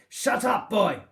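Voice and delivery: very high, loud screaming order; furious scream. Acted. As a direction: scream him into silence. Master yells to silence boy.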